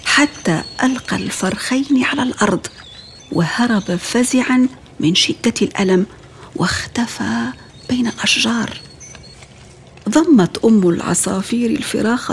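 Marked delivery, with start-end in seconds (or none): none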